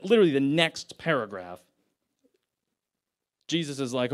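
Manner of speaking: ordinary delivery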